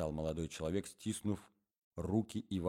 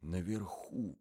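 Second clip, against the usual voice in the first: softer, hushed repeat; whispered; 5 dB quieter, much slower than usual. Acted. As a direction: say it under your breath. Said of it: "прошептал молодой человек".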